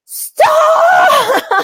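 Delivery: very high, loud mock shout with a laugh; body shouting stop. The body, ignored too long, shouting in a loud high laugh. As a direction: yell it like the body finally snapping. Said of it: "And then it's like".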